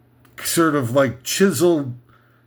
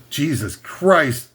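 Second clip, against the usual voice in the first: normal talking voice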